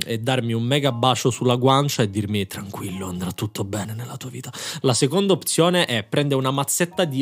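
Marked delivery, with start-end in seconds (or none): 2.5-4.5 soft, tender comforting voice. Pretend. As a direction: comfort him gently. Imagined comforting kiss.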